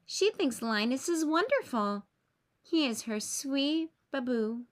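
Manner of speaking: ordinary delivery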